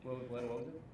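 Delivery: muffled, distant mic. Real, not acted. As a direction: voice muffled as if through a wall. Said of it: "your voice is, like, muffled".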